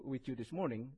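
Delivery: usual speaking voice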